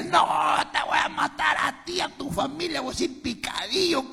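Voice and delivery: furious threat. Retold or imitated. Prisoner shouting threats at his partner over the lost load, in a rage. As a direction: scream the threat at him like you mean it.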